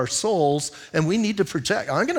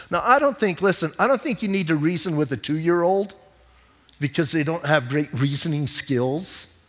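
normal talking voice

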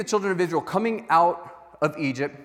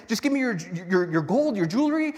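flustered retelling; 4 semitones higher than usual. Acted. Aaron telling Moses how he collected the gold, high and flustered. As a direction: rush the story out defensively.

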